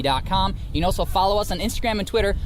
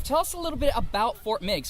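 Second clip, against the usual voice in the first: ordinary talk, nothing marked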